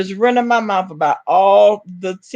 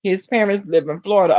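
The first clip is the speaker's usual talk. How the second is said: ordinary delivery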